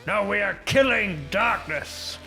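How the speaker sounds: ordinary talk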